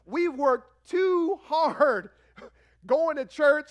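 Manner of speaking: loud, slow, insistent wife; hammed-up stern wife; mock wife's protest. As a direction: lay down the law like a fed-up wife. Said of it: "Your wife is gonna go".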